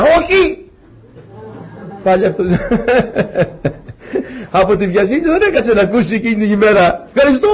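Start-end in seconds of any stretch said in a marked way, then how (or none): 0.0-0.7 very high, explosive mock refusal; hammed-up office yell; imitated boss's shout. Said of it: "φώναζε πολύ δυνατά από το γραφείο". 2.5-7.1 amused, pitched up through laughter; real laughter in the voice; laughing. Real, not acted. Laughing at his own punchline. 7.1-7.5 high, loud, quick thank-you; gleeful thanks. Retold or imitated. Soldier seizing the mistaken refusal.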